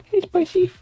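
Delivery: excited pet-talk squeal; high coaxing voice. Real, not acted. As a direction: coo loudly to Spicy way up high. Breaks off to coax Spicy over.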